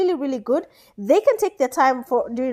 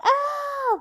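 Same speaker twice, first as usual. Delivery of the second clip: very high cry of laughter; high laughing cry; 14 semitones higher than usual. Real, not acted. Caster overcome at the players, high laughing cry. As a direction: squeal it out in disbelief.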